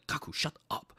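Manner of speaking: hissed hush. Retold or imitated. Kyoshika hushing Gaku.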